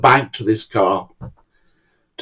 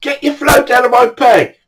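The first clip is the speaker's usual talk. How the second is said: angry shout; 11 semitones higher than usual. Retold or imitated. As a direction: yell at him to clear off. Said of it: "so I shouted".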